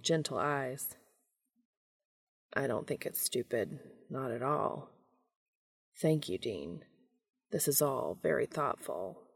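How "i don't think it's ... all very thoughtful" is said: softer, quietly moved gratitude; tender murmured thanks to a friend; soft, halting thanks. A narrator's reading. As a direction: say it gently, holding back emotion. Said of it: "swallowing hard".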